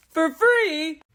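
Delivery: high, loud incredulous question; indignant disbelief. Real, not acted. Vlogger outraged that the cast must coach her unpaid, indignant disbelief.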